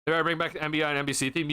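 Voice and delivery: ordinary talk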